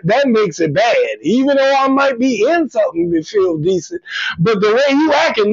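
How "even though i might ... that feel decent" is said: usual speaking voice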